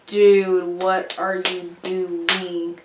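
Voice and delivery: low, very slow, weary disbelief; drawn-out exasperation. Retold or imitated. Girl to boy butting into dance, slow exasperation. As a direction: stretch every word out, fed up and quiet.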